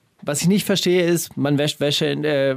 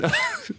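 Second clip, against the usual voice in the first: high squeaky laugh; 5 dB quieter than usual. Real, not acted. Lacht nach dem Zitat.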